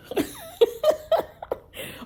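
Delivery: a breathless, voiceless laugh; airy laugh. Real, not acted. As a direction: let out a high airy laugh. Laughing at own complaint.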